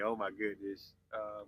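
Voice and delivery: ordinary delivery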